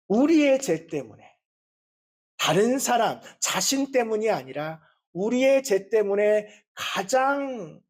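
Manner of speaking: ordinary delivery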